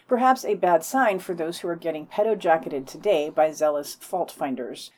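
normal talking voice

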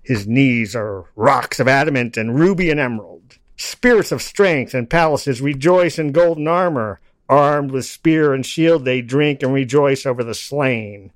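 loud, rhythmic declaiming of a poem; steady chanted verse, each line landed; poetry recital. Acted. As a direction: recite it like a bard to a crowd. Recites Blake poem aloud.

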